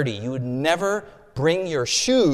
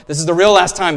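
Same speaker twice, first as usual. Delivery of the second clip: loud, higher plea; pleading promise; 4 semitones higher, 11 dB louder, faster than usual. Acted. Mary promising Jesus this is the real last time, loud plea. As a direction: swear it's truly the last time.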